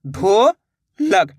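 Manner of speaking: "ढोलक" split into a long rising "ढो", pause, then "लक"; syllable by syllable. Shown as a demonstration. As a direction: sound out "ढो ... लक" very slowly. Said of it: "दोनों को मिला देंगे तो ढोलक". ("ढोलक" को तोड़कर धीरे-धीरे: ढो ... लक)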